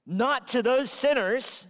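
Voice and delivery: parents warning. Retold or imitated. Parents warning, voiced by preacher.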